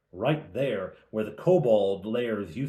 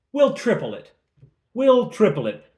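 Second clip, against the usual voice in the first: bold offer; 11 semitones higher, 9 dB louder than usual. Retold or imitated.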